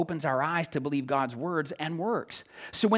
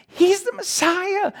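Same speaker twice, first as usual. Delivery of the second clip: much higher, louder, slow drawn-out cry; mock jubilant crowd; 7 dB louder, slower than usual. Preacher acting followers seeing miracles, jubilant. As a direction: proclaim it in slow triumph.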